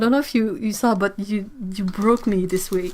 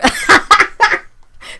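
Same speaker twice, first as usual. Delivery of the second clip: loud high laugh; 12 semitones higher, 9 dB louder than usual. Real, not acted. Reading Slayer, bursting into loud laughter.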